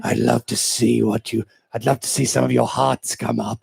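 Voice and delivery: slow, low, hammed-up creepy purr; a mock-sinister invitation. Mocking the fan invite as a creep, slow and sinister. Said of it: "It sounds really creepy".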